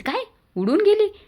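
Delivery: "उडून गेली?" shot up very high and loud in shock; mother stunned that the umbrella blew away; shocked disbelief. Acted. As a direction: gasp it out, utterly stunned.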